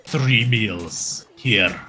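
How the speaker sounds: slow, low, gruff orc. Acted. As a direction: drawl it out like a big orc. GM voices the orc.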